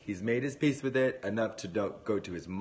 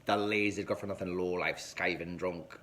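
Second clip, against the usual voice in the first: a light Northern Irish mum's scolding; sling the insults in a hint of Belfast; Northern Irish mum, lightly put on; Belfast accent; 2 semitones lower than usual. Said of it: "it's the Northern Irish Belfast woman".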